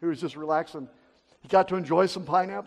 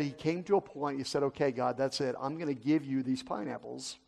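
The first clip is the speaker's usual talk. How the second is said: soft, sincere prayer to God, much softer; quiet surrender prayer; 7 semitones lower, 9 dB quieter, faster than usual. Retold or imitated. Missionary giving his pineapples to God, soft sincere prayer. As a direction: hand it over to God quietly, meaning it.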